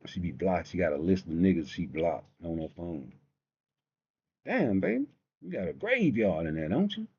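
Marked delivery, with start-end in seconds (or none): 4.4-7.1 high swooping teasing voice; mock teasing. Teasing the girl with the block list. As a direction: tease her like a show host.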